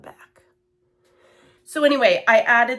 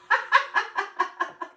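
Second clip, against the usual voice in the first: laugh; 13 semitones higher than usual. Real, not acted. Laughs at own clumsiness.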